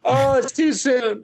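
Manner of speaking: slow, high mock anguish; mock pain. Host jokes about his cancelled show, slow mock anguish. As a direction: play the wound as fresh, hammed-up.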